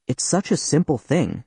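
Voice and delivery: usual speaking voice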